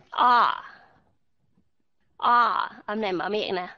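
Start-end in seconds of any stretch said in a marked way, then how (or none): 0.1-0.6 O said long and loud with an open mouth; model sound O. Shown as a demonstration. Teacher modelling O loudly for students. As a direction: hold the O wide open. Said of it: "các bạn nghe nó giống âm gì?".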